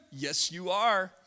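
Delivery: ordinary delivery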